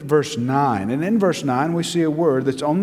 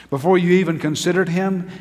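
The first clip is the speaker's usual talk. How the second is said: ordinary delivery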